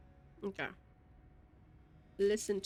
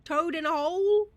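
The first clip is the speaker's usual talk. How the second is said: emphatic; 6 semitones higher, 8 dB louder than usual. Real, not acted. Mock indignant example.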